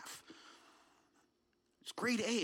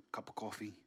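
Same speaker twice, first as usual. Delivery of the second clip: ordinary delivery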